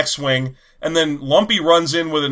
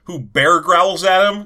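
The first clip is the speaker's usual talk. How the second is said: usual speaking voice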